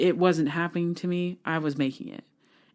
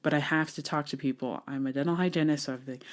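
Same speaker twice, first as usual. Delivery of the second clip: normal talking voice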